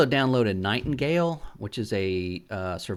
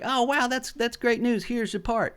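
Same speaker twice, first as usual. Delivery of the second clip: higher, chirpy mock delight; pleased Doug; 10 semitones higher than usual. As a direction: act pleased in a cartoonish way. Doug delighted to get news.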